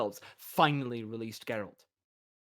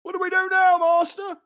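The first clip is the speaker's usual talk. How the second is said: much higher, loud shout; triumphant yell; 8 dB louder than usual. Acted. Masons shouting to their master.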